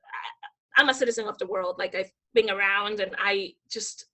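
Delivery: usual speaking voice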